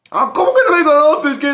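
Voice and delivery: high, loud shocked protest; a rejected believer's loud shocked protest; indignant disbelief. Acted. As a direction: cry out as if wronged.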